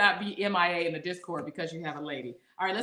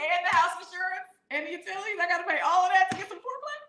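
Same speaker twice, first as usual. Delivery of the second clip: high, rising disbelief, speeding up; high-pitched mock outrage; incredulous; 10 semitones higher, faster than usual. Real, not acted. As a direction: pile on the costs in shock. Listing costs in disbelief.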